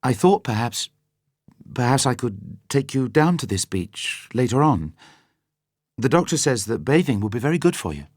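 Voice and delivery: lightly voiced hopeful woman; hopeful young woman. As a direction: offer it gently, hoping. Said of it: "She continued bravely".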